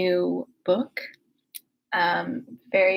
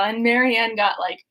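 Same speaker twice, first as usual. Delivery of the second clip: ordinary delivery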